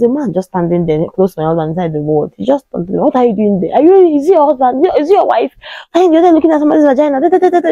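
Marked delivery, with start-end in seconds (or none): 3.0-7.7 retold angry shout. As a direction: imitate the husband yelling at a stranger. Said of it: "he shouted".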